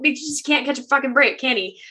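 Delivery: normal talking voice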